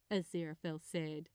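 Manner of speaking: ordinary delivery, nothing marked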